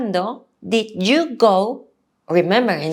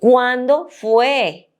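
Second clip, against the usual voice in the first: ¿Cuándo fue? said slowly, word by word; teacher's slow demo; slow model question; 3 semitones higher, 3 dB louder, much slower than usual. Shown as a demonstration. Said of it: "we use the verb".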